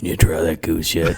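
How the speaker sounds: very low mock uncle voice; mimicked uncle. Cousins mimicking their uncle at family dinner, low put-on voice. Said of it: "Sven and Thor and I will go up to each other".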